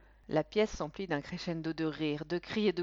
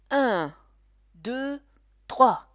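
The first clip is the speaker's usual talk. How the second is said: called-out countdown, louder on trois; loud count; 6 semitones higher, 12 dB louder, much slower than usual. A narrator's reading. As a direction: count it out for the crowd. Counting for the trick. (compte à voix haute)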